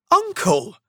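high, pleading cry; begging. A narrator's reading. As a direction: implore your uncle to relent. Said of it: "begged the nephew".

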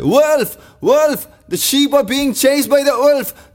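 high-pitched cry of alarm; shouted alarm. Acted. Shepherd boy fooling the villagers, high shouted alarm. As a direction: call out wolf in a high, pretend panic. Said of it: "he shouted".

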